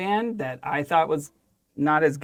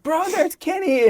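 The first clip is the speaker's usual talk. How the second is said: high, slow excited bro call; hyped bro; 13 semitones higher, 4 dB louder than usual. Retold or imitated. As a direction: call out like a stoked surfer friend. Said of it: "and they're like".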